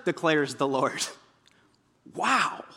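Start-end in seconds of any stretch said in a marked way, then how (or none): none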